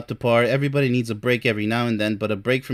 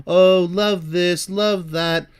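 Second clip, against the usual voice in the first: mocking quote; 4 semitones higher, much slower than usual. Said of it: "when they speak of love".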